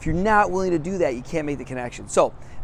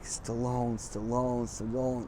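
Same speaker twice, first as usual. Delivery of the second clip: low, soft repetition to oneself; hushed, low self-reminder; rehearsing under breath; 3 semitones lower, 10 dB quieter, much slower than usual. Retold or imitated. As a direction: quietly drill the name into your head. Rehearses the right name to himself.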